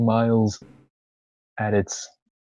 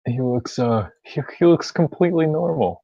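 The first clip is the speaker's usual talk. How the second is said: mock insistent; 5 semitones higher, 5 dB louder than usual. Insists man looks normal.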